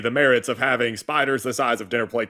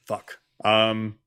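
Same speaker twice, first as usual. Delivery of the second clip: ordinary talk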